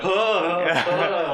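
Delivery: short high yelps of alarm; mock flailing cries. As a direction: yelp like you're losing your footing. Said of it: "I, like, sort of go like".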